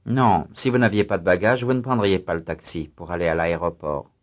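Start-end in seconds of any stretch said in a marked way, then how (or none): none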